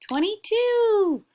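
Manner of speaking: high, loud and drawn out; excited reveal. Real, not acted. Teacher counting to today's date, rising high. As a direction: land the last number with delight.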